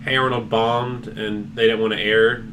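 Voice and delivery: ordinary delivery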